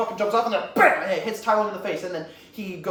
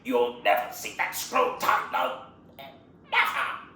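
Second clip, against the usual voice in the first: strained, mostly voiceless rasp; halting, effortful defiance; Shifu. Acted. Wounded master taunts villain about the scroll, strained defiance. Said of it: "Shifu goes".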